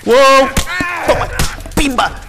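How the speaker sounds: high loud triumphant yells; excited cheer. Real, not acted. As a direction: cheer the hit loudly. Cheering a kill.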